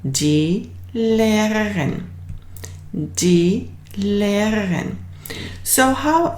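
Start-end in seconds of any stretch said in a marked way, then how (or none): 0.0-5.0 Lehrerin said slowly syllable by syllable; slow, clear, teacher modelling the word; slow model pronunciation. Shown as a demonstration. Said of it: "then we'll use the word".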